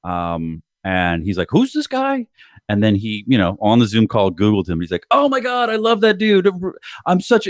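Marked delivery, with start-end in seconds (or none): 1.5-2.3 excited friend. Retold or imitated. Friend quoted reacting. 5.1-7.5 gushing fan. Retold or imitated. Friend gushing over actor.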